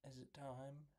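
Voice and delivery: soft, voiced question to oneself; hushed but not whispered; quiet musing. Real, not acted. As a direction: ask it under your breath, still voiced. Asking himself before the recap.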